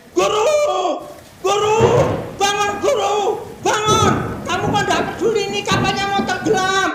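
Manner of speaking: loud, high pleading shout to wake him; desperate shout. Acted. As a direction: shake the teacher awake in panic. Disciple wakes Jesus in panic. (murid membangunkan guru sambil berteriak panik)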